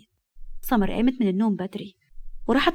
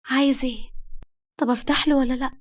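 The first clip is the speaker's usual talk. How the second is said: confused; 4 semitones higher than usual. Acted. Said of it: "وكملت بحيرة".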